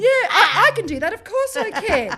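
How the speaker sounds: very high, giggly false confidence; retold squeaky over-keen agreement; overeager yes. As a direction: chirp it far too keenly, laughing at yourself. Mimics own feigned confidence.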